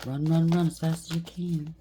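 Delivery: ordinary talk, nothing marked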